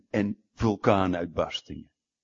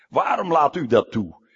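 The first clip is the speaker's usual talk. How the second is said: louder angry outcry as Jonah; 5 semitones higher, 9 dB louder than usual. Retold or imitated. Jonah shouts at God about his tree, angry. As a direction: Jonah hurls the question at God. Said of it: "Jona vreselijk boos".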